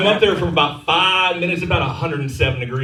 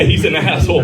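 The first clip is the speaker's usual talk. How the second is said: ordinary talk, nothing marked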